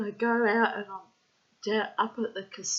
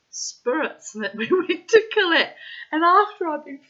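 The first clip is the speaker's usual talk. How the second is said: laughing; 7 semitones higher, 8 dB louder than usual. Real, not acted. Laughing while recounting.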